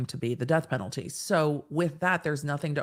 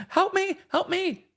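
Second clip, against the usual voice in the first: tiny, very high-pitched cry for help; comically high, drawn-out wail; mock screaming for help. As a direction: squeal a hammed-up plea for rescue. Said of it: "in tears with you screaming".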